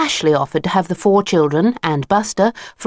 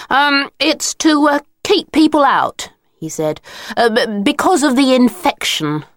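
nervous stammer; 8 semitones higher than usual. Acted. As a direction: stall with ums like a caught-out boy. Leader caught out, improvising excuse.

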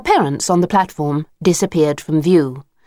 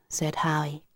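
usual speaking voice

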